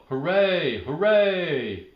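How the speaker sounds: high, loud happy cheer; yelled cheer. Acted. Norma Jean cheering for Ted, high and loud. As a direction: shout hooray for the winner. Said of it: "She yelled".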